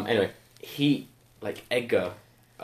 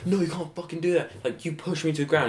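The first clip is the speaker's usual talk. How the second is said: high-pitched imitation of indignant complaint; acted-out outrage, not shouted; mock angry protest; 7 semitones higher than usual. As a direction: whine in protest, imitating a wound-up kid. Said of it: "gets mad".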